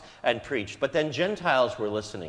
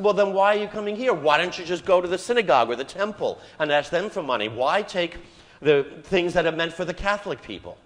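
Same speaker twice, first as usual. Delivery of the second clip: higher, louder indignant outburst; indignant secretary; 5 semitones higher, 5 dB louder than usual. Retold or imitated. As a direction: let the jaded secretary's scorn rise. Secretary's indignant retold rant.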